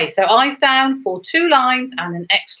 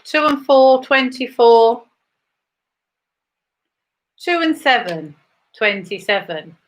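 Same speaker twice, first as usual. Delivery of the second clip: bingo caller; 2 semitones higher, 3 dB louder than usual. Acted. Bingo calls between chat.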